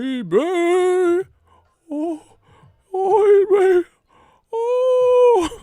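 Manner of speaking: very high, loud, crying wail; high screaming wail. Retold or imitated. Mimicking Paul Bearer again, very high loud wail. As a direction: wail like an overexcited manager.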